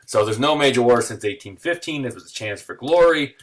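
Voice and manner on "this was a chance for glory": ordinary talk, nothing marked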